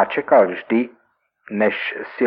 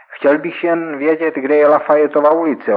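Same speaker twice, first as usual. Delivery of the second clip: higher, louder smirking reply; 7 semitones higher, 6 dB louder than usual. Acted. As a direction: sneer the question back at him. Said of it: "odpověděl jsem mu s úšklepkem". (s úšklebkem, výš a hlasitěji)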